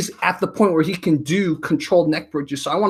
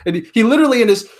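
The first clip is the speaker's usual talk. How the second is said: usual speaking voice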